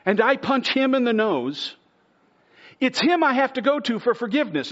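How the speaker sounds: loud, high, building preacher's cry; fervent sermon climax; preaching at full force. Acted. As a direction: drive the point home from the pulpit.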